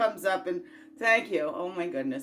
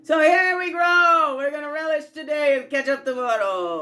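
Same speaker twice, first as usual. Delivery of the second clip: shouted catchphrase; 6 semitones higher, 10 dB louder than usual. Real, not acted.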